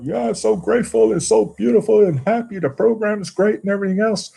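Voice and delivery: high, gushing put-on voice, mocking; mock grateful guy. Mocking a man at the meeting who claims gratitude, high gushing voice. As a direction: parody a sugary newcomer who's so grateful. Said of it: "this guy was sitting in the room one night going".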